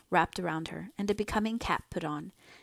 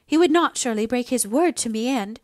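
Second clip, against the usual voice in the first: louder, higher indignant protest; more displeased; 5 semitones higher, 8 dB louder than usual. A narrator's reading. Mother fearing the doctor broke his word, displeased. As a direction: protest sharply until cut off. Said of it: "Missus Hale became more displeased".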